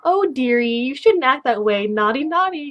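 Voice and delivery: high, loud, sing-song mock scolding; perky scolding girl. Alter ego answers someone who angered her, sweetly scolding. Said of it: "kind of attitude".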